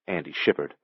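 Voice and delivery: usual speaking voice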